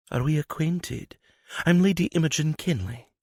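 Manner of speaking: a proper lady introducing herself, guarded; Imogen's voice. Acted.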